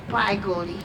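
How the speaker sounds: ordinary talk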